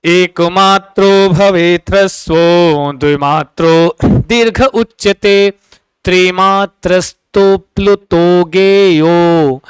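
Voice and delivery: slow chanted shloka; sanskrit recitation. Shown as a demonstration. Teacher reciting a Sanskrit verse to students, slow chant. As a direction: intone the verse slowly like a recitation. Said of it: "प्लुत कहता है".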